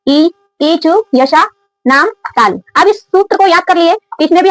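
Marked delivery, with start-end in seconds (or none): 0.1-2.6 slow recitation. Shown as a demonstration. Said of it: "ठीक है".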